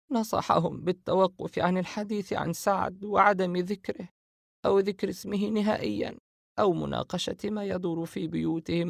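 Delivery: a hint of a worried sister retelling advice; [name]'s voice.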